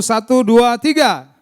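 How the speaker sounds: loud, high count to cue a crowd; rallying count-in. Real, not acted. Preacher asking the congregation to shout together, loud count-in. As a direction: count them in for a big shout. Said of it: "sama-sama berseru".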